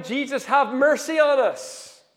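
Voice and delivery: loud, high, drawn-out plea of the blind men; retold desperate cry, loud and high. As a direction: call out for mercy, stretching the words. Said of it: "And they're crying".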